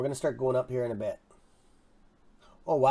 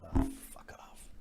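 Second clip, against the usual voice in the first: whispered curse; 7 semitones lower, 15 dB quieter than usual. Real, not acted. Streamer annoyed at an ad, whispering an annoyed curse to himself. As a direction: hiss it under your breath.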